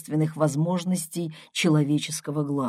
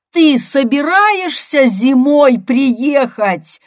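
loud, high, slow bellow; angry yell; 9 semitones higher, 9 dB louder, slower than usual. A narrator's reading. Brother demanding his sister visit in winter, yelling. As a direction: roar each word out slowly. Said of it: "заорал я".

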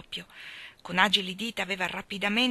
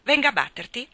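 threatening; 6 semitones higher, 5 dB louder than usual. A narrator's reading. Master threatening the girl.

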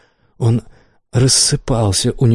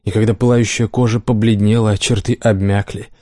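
ordinary delivery

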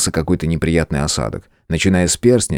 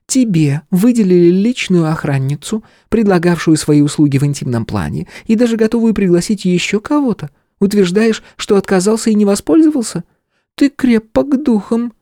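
higher female voice, a touch skeptical; 14 semitones higher than usual. Acted. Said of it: "немного скептически произнесла внучка губернатора". (с лёгким скепсисом)

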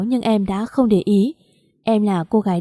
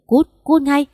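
raised, higher-pitched angry dismissal; angry order to leave; 5 semitones higher, slower than usual. A narrator's reading. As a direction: order him out angrily, pitch lifted. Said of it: "Lâm quát".

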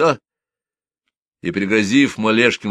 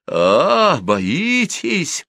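high roar, hard on the first sound; 9 semitones higher, 3 dB louder, much slower than usual. A narrator's reading. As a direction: roar out a scornful challenge. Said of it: "заревел Щербак". (взревел, высоко и с напором)

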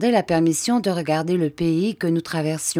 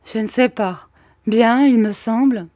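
put-on dazed boy answering; 7 semitones higher, 6 dB louder than usual. Dazed hero answers after fall.

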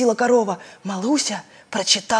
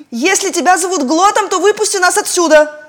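stern; 10 semitones higher, 5 dB louder than usual. A narrator's reading. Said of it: "строго сказала Маруся".

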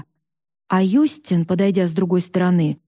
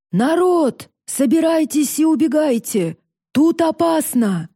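shouted warning; 6 semitones higher than usual. A narrator's reading. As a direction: call everyone out urgently, pitch raised. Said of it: "Голос папы срывался на хрип. Он громко кричал". (встревоженный призывный крик)

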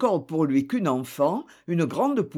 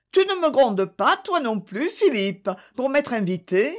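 put-on playful girl; 7 semitones higher than usual. A narrator's reading. Thérèse teases Philippe.

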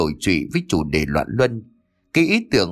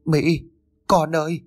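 sobbing call; 6 semitones higher than usual. A narrator's reading. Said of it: "bà Tư nức nở gọi lớn".

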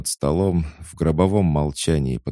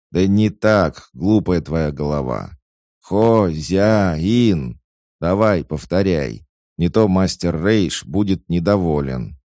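loud, high, exasperated correction; stern scolding; 4 semitones higher, 7 dB louder, slower than usual. A narrator's reading. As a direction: drill a hopeless pupil loudly. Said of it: "ещё и строго выговаривал". (строгая громкая отповедь)

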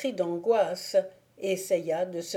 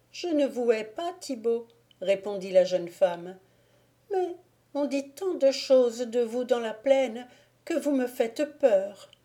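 put-on higher, timid young woman; Agnolette's voice; 4 semitones higher than usual. Agnolette answers Thibaut, higher and timid. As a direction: speak as the gentle frightened girl.

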